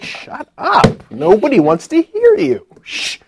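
a high, loud, breathy shushing God; God hushing him. Retold or imitated. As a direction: shut him up like an impatient God.

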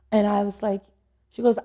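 ordinary talk

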